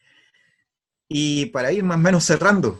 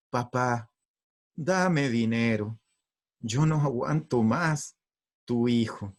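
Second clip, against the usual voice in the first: tender letter reading; slower than usual. Acted. Wife rereading son's letter to father, tender. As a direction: read it gently, lingering on each phrase. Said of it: "si me lo hubiese pedido así".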